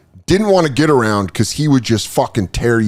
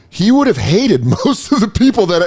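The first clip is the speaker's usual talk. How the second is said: laughing retort; 7 semitones higher than usual. Retold or imitated. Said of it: "And you go".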